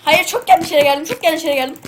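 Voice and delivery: loud high dismayed cry; dismayed shout. Real, not acted. Dismay in game.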